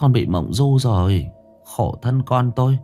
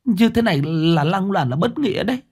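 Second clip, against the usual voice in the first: higher, rushing, pleading woman; frantic pleading widow, climbing higher; desperate pleading; 7 semitones higher, faster than usual. A narrator's reading. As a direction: beg him, rising in panic.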